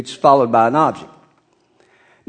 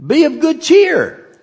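high and loud, ringing out be of good cheer; lifting a frightened crowd's spirits, loud and high; rallying call; 12 semitones higher, 5 dB louder than usual. Acted. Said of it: "He says".

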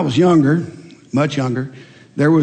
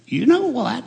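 high parrot punchline; high bird voice for the joke; 7 semitones higher than usual. Acted. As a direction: deliver it as a sly talking parrot. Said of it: "The parrot said".

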